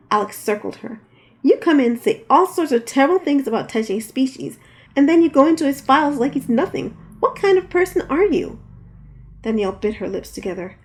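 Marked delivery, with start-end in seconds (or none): 1.4-8.6 loud, rising higher to a high furious question; angry accusation. Acted. Alex accusing Danielle over Tajay's files, louder and higher. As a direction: build the outrage up to the last question.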